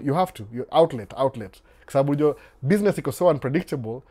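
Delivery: ordinary delivery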